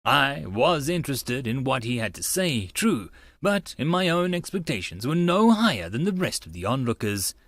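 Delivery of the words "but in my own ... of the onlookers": ordinary delivery, nothing marked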